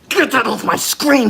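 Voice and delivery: high, loud snapped order; annoyed shout. Real, not acted. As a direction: bark it at the screen. Streamer banning an onscreen gift.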